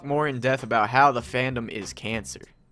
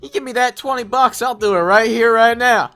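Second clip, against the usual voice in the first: much higher and louder, eager yokel; 10 semitones higher, 9 dB louder than usual. Acted. A rowdy guy accepting twenty bucks for the dare, eager and loud.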